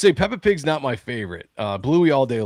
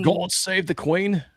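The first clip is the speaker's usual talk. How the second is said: higher, slow attempt at a British accent; hammed-up British, questioning; badly faked British accent; 6 semitones higher, much slower than usual. Shown as a demonstration. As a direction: drag it out in a clumsy British accent. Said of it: "That's, that's horrible".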